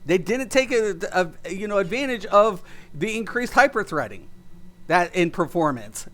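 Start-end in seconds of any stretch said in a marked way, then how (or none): none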